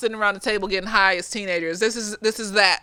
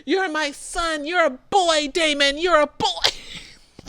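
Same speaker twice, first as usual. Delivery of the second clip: slow, higher, hammed-up sobbing plea; mock crying plea; 6 semitones higher, 4 dB quieter, slower than usual. Father begging his child, hammed-up sobbing. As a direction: overact a heartbroken dad.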